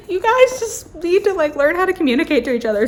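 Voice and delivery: high, exasperated plea to the couple that speeds up at the end; exasperated scolding. Retold or imitated. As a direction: lecture fictional lovers in mock frustration. Scolding the book's couple on a plane.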